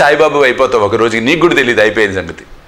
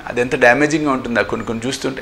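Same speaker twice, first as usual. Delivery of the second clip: ordinary talk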